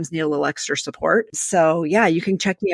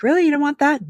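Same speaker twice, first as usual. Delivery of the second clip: surprised daughter; 10 semitones higher, faster than usual. Retold or imitated. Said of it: "I'm like".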